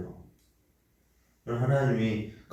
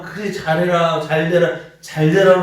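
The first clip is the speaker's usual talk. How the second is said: higher, louder, building blessing; imitating an urging parent; 7 semitones higher, 10 dB louder than usual. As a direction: cheer the child on, growing louder. Parent's encouraging words voiced. (자녀를 격려하는 부모의 점점 커지는 외침)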